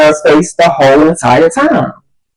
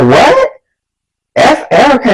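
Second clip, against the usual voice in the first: higher, disbelieving echo; mock-shocked friend, pitched up; incredulous; 7 semitones higher than usual. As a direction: repeat it back as a shocked question. Girl repeats it in disbelief.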